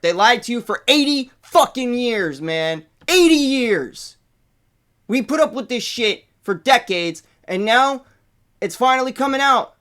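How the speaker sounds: drawn-out outraged "Eighty years"; upset rant. Real, not acted. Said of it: "I'm upset".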